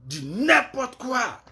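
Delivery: slow, drawn-out scorn; exasperated dismissal. Real, not acted. Scolding the women on her live, slow and scornful. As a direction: drag the words out in disgust.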